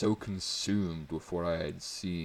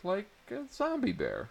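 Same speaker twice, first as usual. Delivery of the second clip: excited. A narrator's reading. Abby's excited idea.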